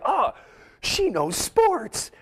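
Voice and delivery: high, drawn-out admiring coo; mock swooning. Host mimicking men impressed by a woman on a sports show, high swooning.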